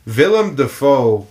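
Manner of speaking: Willem said with a V, "Villem"; German W. Real, not acted. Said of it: "so it's Willem".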